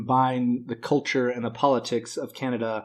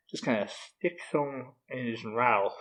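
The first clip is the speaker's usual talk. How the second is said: normal talking voice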